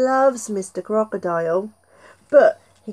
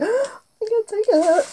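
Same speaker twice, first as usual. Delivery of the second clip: excited gasp; 12 semitones higher than usual. Acted. Gasp of delight to children.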